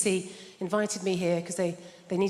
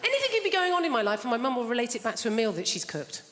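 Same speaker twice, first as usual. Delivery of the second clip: ordinary delivery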